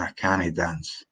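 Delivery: Scots "cannae" in two syllables. Shown as a demonstration. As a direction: demonstrate Scots, can't becoming "cannae". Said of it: "In Scots, we say".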